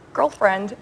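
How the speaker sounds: put-on "Girlfriend" with a hard American r, "gurlfrend"; put-on American accent on "Girlfriend", r rolled in; American accent attempt. Shown as a demonstration. Australian actress tries American on the spot, American accent. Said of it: "Now try and say it in American".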